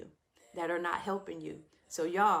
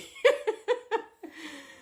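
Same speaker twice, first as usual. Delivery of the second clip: high giggling laugh; high laughter; 8 semitones higher, 3 dB louder than usual. Real, not acted. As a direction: keep laughing helplessly. Still laughing at husband.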